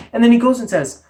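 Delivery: ordinary talk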